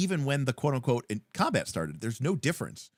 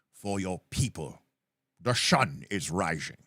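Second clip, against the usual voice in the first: epic film speech; 3 semitones higher than usual. Retold or imitated. Reciting film battle speech.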